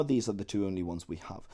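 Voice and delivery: ordinary talk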